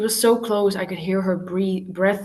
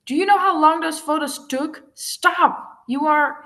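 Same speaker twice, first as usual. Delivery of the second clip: higher, angry outburst; furious protest; 9 semitones higher, 4 dB louder than usual. A narrator's reading. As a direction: struggle and shout at him. Ava yanks Alex's arm.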